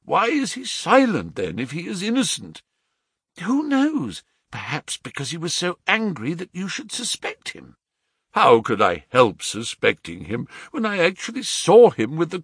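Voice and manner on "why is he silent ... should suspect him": put-on lightly raised, pleading young lady; a hint of an anxious niece; young woman pleading. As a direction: plead gently for her cousin. Narrator voices pleading Mary.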